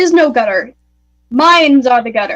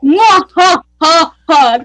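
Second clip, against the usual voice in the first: slow hammy villain laugh; sneering panto villain; 4 dB louder, much slower than usual. Pretend. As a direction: cackle like a gloating pantomime baddie. Gloating over pie, not sharing.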